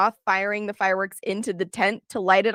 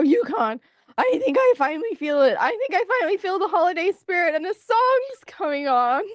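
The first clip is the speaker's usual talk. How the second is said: excited; 8 semitones higher than usual. Acted.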